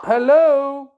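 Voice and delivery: loud repeated hello, waiting for a reply; calling out on phone. Real, not acted. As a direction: call down the phone for someone to answer. Calling into silent phone line.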